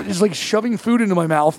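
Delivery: normal talking voice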